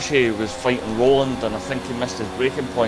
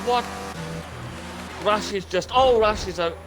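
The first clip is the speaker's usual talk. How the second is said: sudden shock, voice jumping higher; surprised; 8 semitones higher, 5 dB quieter than usual. Real, not acted. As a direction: react to the crash, stunned. Co-commentator sees crash.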